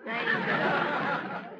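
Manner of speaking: Zuzu said slowly, each syllable drawn out; correcting her name. Real, not acted. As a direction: insist on "Zuzu" with a laugh. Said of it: "Hello, Zuzu".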